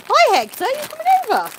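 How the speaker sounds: very high, loud call; pet talk. Real, not acted. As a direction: call him over fondly. Calling to an animal.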